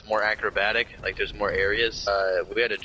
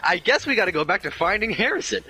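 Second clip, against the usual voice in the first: mock casual; 7 semitones higher than usual. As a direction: toss it away like it's no big deal. Friend voiced in dream story.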